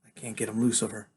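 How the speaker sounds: ordinary delivery, nothing marked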